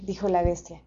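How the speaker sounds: plain reading voice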